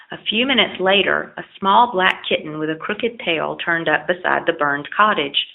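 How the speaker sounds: usual speaking voice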